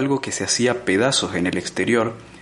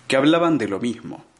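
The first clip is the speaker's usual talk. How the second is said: ordinary delivery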